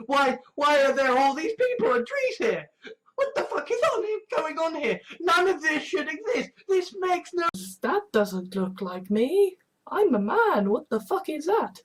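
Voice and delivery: a hammed-up baffled shout; bewildered rant. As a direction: demand answers, mock outraged. Ranting at a confusing image.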